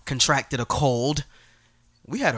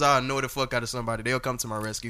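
ordinary talk, nothing marked

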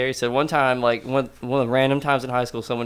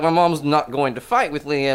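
ordinary delivery

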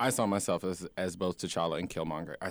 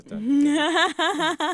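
high, loud slow hype announcement; 13 dB louder, much slower than usual. Retold or imitated. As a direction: boom it like a hype man.

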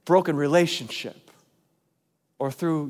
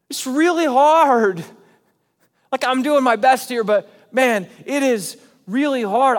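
higher, louder, pleading preaching; impassioned preaching; 6 semitones higher, 6 dB louder than usual. Real, not acted. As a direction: pour out the struggle, straining up high. Preacher voicing doubt at full force.

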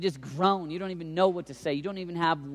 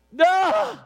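loud, high, drawn-out shout; wordless worship yell; 8 dB louder than usual. Retold or imitated. Imitating college kids declaring to God, loud high outburst. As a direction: burst out with one huge cry.

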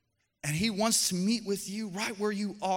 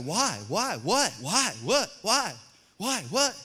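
put-on kid's endless whys; slower than usual. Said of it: "the why phase of your kids".

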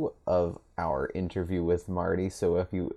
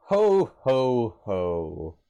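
Santa ho ho ho; 4 semitones higher, much slower than usual. Pretend. Holiday greeting after Christmas talk.

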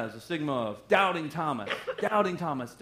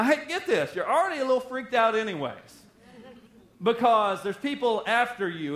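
ordinary talk, nothing marked

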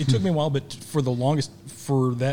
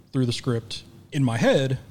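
ordinary talk

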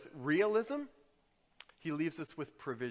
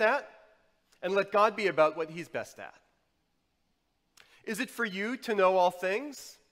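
high, loud exhortation; full-force preaching; 6 semitones higher, 10 dB louder than usual. Acted. Preacher warning worriers against controlling everything, full-force preaching. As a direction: hammer it out to the congregation, pitched up.

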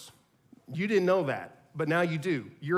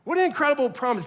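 higher, louder and faster; excited emphasis; 8 semitones higher, 4 dB louder, much faster than usual. Shown as a demonstration. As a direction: burst out with wonder. Repeats the phrase, louder.

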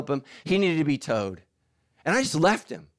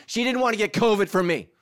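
usual speaking voice

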